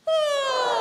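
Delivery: high loud ah sliding down; vocal slide high to low. Shown as a demonstration. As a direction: start high and glide down to low. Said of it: "slide from high to low".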